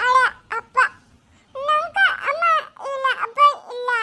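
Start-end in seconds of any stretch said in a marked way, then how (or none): 1.6-3.9 sobbing out the words through tears; a small child crying for her dad; a little girl sobbing that her father is not there. Acted.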